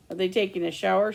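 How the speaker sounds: ordinary delivery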